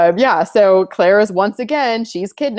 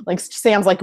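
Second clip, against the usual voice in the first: ordinary talk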